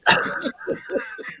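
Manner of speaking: a burst of high laughter. Real, not acted. Co-host laughing hard at the host's apology. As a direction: break into a high squeal of a laugh.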